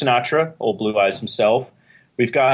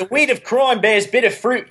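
hammed-up Shadow narrator; 9 semitones higher than usual. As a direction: ham up the old radio narrator line. Quoting The Shadow radio show.

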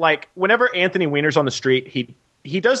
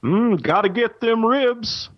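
mock slam poetry; 8 semitones higher than usual. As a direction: croon it like a slam poet. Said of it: "reading some slam poetry".

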